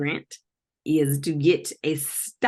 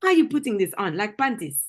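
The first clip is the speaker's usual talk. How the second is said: higher-pitched mock African accent; African interrogator, accusing and incredulous; 5 semitones higher than usual. Confronting someone over found belongings, mock African accent.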